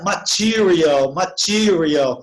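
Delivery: "material" slowly as ma-TI-rial; English pronunciation. Shown as a demonstration. Teacher modelling the next word for students, drawn-out English "material". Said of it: "então muda a pronúncia".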